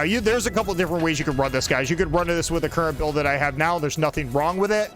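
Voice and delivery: ordinary talk, nothing marked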